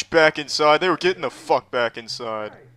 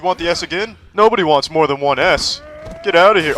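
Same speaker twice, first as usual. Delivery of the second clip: taunting yell; 3 semitones higher, 8 dB louder than usual. Pretend. Taunting enemy mid-fight.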